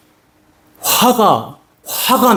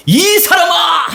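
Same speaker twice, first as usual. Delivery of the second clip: angry retort. Retold or imitated. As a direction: bark back in anger. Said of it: "화를 내는 거예요".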